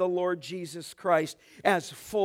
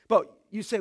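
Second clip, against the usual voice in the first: ordinary talk, nothing marked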